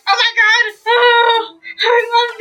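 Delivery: high, wailing fake despair; hammed-up panicked whine; mock panic. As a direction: act out a character panicking as the others race ahead. Voicing Deku panicking as exam starts.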